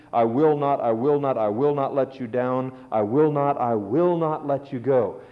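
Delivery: "I will not" said over and over, leaning on "will"; repeated for emphasis. Shown as a demonstration. Preacher quoting God's promise, stressing "will" on each repeat. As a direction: hammer the repeats, pressing "will". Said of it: "repeated two or three times, it's for emphasis".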